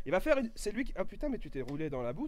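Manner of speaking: normal talking voice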